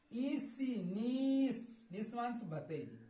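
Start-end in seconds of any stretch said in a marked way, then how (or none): 0.9-1.7 नीस with a long ee. Shown as a demonstration. Teacher showing how i-e is read, नीस with long ee. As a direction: stretch the ee in नीस. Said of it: "इसे क्या पढ़ेंगे".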